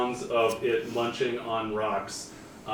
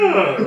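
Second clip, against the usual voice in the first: mock snail roar; 7 semitones higher, 10 dB louder than usual. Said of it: "looked at you and went".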